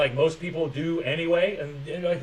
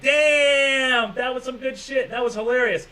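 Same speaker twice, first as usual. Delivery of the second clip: mock delighted reader; 7 semitones higher, slower than usual. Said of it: "and be like".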